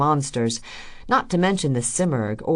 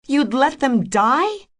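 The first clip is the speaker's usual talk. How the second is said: high, slow, loud disbelief; appalled at him; horrified; 11 semitones higher, 6 dB louder, slower than usual. A narrator's reading. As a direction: Rose recoils at Freecloud's answer. Rose horrified.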